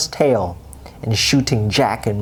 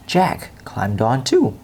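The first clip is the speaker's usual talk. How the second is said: plain reading voice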